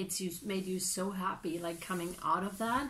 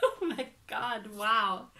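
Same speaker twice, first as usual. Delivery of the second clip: laughing oh my god; slower than usual. Real, not acted. Laughing reaction.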